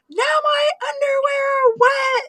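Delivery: gasp, then loud, very high wail; hammed-up bawling little boy; imitating screaming cry. Six-year-old pranked by mom, mock screaming and crying. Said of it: "He screamed and cried".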